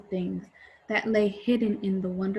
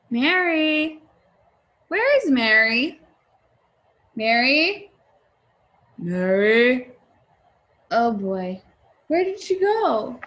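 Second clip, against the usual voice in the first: higher, louder anxious calls for Mary; worried calling; 3 semitones higher, 7 dB louder than usual. Acted. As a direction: call out for her, getting more worried. Parents searching for lost daughter.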